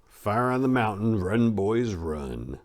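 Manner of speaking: ordinary delivery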